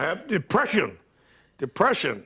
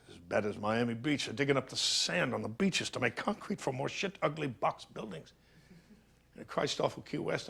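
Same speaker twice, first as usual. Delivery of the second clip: much softer, sinking into bitterness; bitter quiet lament; 8 dB quieter than usual. Acted. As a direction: mourn the lost country under your breath. Bitter lament after the rant.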